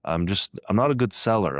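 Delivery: ordinary talk, nothing marked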